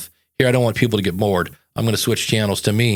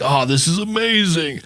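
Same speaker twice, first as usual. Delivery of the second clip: retold awe; 4 semitones higher than usual. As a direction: play the awestruck fan. Retells first hearing a huge album.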